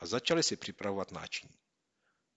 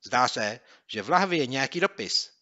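higher and louder, calling out the find; excited cry; 4 semitones higher, 7 dB louder than usual. Acted. Boy spotting a letter in the bottle, excited cry. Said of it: "vykřikl Měouk".

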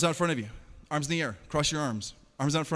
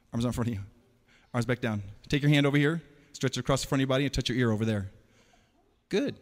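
ordinary talk

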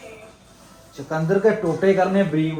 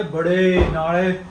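ordinary talk, nothing marked

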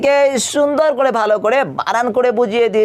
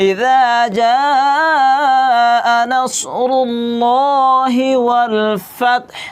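slow melodic recitation; Quran recitation; 3 semitones higher, much slower than usual. Shown as a demonstration. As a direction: chant the whole sura slowly and clearly. Recites the whole sura as a model. (পুরো সূরা ধীর সুরে তিলাওয়াত)

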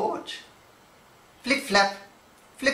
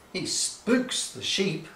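usual speaking voice

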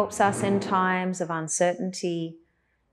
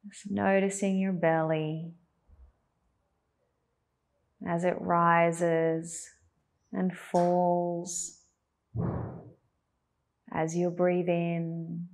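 calm, even cues with long pauses; unhurried, guiding attention to the breath; guided meditation. Acted.

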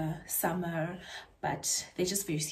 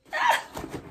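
squealing laugh. Real, not acted. Delighted by new shoes.

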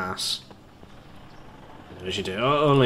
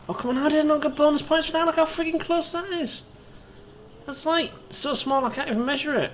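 very high-pitched complaint; indignant protest. Real, not acted. Player protesting no bonus points, indignant squeal. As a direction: whine in disbelief, way up high.

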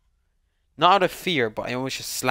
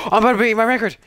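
excited; 9 semitones higher, 8 dB louder, much faster than usual. Real, not acted. Gasping excitement near a record.

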